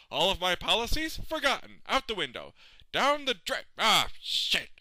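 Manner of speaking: high, agitated self-scolding; frustrated rant. Acted. President lamenting his ruined legacy, agitated. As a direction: rant in rising despair at yourself.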